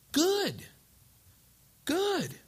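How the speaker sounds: high, falling emphatic good; spring it on them with conviction. Retold or imitated. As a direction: pastor startling the couple, high and emphatic. Pastor's surprising reply.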